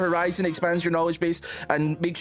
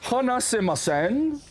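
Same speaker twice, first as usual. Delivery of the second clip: slow, drawn-out mock Japanese accent; Japanese accent attempt; 4 semitones higher, slower than usual. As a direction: stretch each Japanese word, swooping in pitch. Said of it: "my attempt at a Japanese accent".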